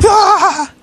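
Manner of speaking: very high cartoon scream; mock scream. Imitating a chef waking from a nightmare, screaming. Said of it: "It's like".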